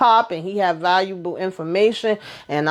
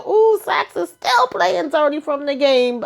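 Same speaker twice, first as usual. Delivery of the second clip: higher, delighted "ooh"; amused surprise; 8 semitones higher than usual. Real, not acted. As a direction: gasp of gossip delight. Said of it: "I said".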